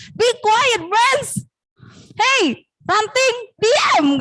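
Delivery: high, playful scolding yell; acted yelling. Big mouse shouts at the wind to be silent, mock yell. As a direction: order the wind to hush. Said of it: "Dia teriak-teriak".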